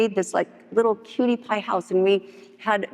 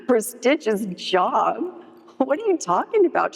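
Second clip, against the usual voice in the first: drawn-out, high, swooping mock incredulity; scornful disbelief; 6 semitones higher, slower than usual. Coworker scoffing at a prestigious job, incredulous. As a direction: scoff at the very idea, stretching it out.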